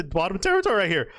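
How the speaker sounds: ordinary talk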